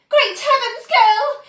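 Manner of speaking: high, loud squeak. A narrator's reading. Mother squeaking in shock at her daughter, high and loud. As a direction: squeak it out in alarm. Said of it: "squeaked Missus Beauregard".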